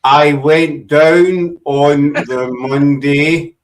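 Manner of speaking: very slow, deliberate word by word; careful translated-for-outsiders English. Shown as a demonstration. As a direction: spell out "I went down on the Monday" slowly, each word separate. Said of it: "now I'm getting a lecture".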